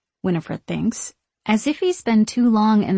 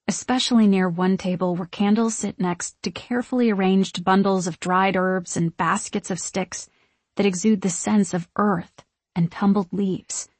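ordinary delivery

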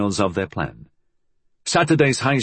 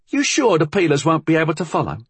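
put-on higher, fast anxious girl; worried girl; 7 semitones higher, faster than usual. A narrator's reading. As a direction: ask it quickly, uneasy. Molly worries.

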